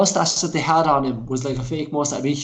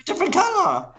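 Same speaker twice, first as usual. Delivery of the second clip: much higher, slow sing-song doorman voice; mock doorman; 14 semitones higher than usual. Mimicking the doorman who spots the slippers, high slow sing-song. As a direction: squeak it out, drawn long.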